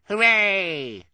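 high, loud drawn-out hooray; cheer. A narrator's reading. Cheer voiced in story.

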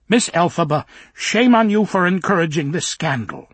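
put-on high, fussy old nanny voice; scolding old nanny. Minder scolding Elphaba.

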